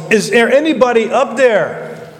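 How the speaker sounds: high-pitched call up the cliff; acted yell to someone far above. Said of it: "he yells".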